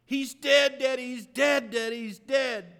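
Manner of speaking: high loud repeated wail; retold scream. Daughter sobbing the news to her father, loud high wail. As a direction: cry it out over and over, frantic. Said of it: "She screamed in the phone".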